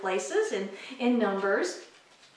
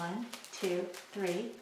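much softer, almost under-the-breath count; quiet count; 5 semitones lower, 12 dB quieter, slower than usual. Shown as a demonstration. Teacher doing the real shake, counting softly. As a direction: barely voice the count as if not really saying it. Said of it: "Except we don't say one, two, three".

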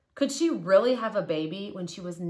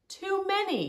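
high, exasperated sigh of too many; a storybook Abraham giving up, pitched high. A narrator's reading. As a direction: throw up your hands, it's hopeless. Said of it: "he said".

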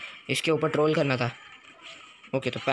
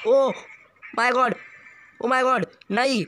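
stunned oh my God; 7 semitones higher, much slower than usual. Real, not acted. Gamer escaping death by a hair, stunned high cry. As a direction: cry out in disbelief at surviving.

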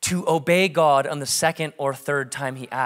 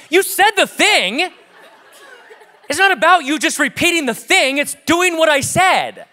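a little mad; 10 semitones higher, 5 dB louder than usual. Retold or imitated. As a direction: lecture her with half-laughing frustration. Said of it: "getting a little mad".

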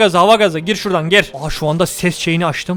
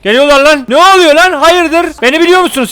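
alarmed yelling; 7 semitones higher, 6 dB louder than usual. Real, not acted. Enemies closing in.

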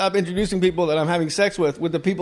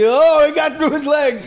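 high, loud, drawn-out sportscaster call; mock play-by-play; 9 semitones higher, 6 dB louder, slower than usual. Host joking as baseball commentator, excited mock call.

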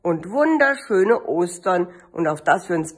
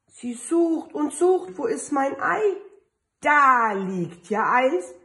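storybook reading; 5 semitones higher than usual. Acted. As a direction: read it like a picture book to a kindergarten group. Reads picture book to children.

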